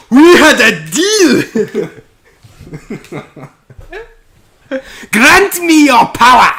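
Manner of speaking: high, very loud, drawn-out bellowing king; grand proclamation at the top of the voice; Rastakhan. Acted. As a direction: boom it out in a hammed-up strained shout. Acting out Rastakhan's game lines.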